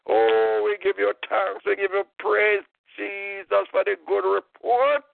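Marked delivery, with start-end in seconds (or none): none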